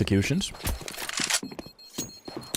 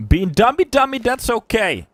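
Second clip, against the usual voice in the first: high, loud burst of excitement; sudden excited caster yell; 13 semitones higher, 7 dB louder than usual. Real, not acted. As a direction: shout it out at the play. Caster yelling at a play.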